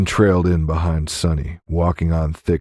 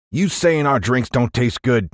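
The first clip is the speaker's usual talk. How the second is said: challenging tall cowboy; 8 semitones higher than usual. A narrator's reading. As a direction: question it like an affronted cowboy. Tall man challenges William.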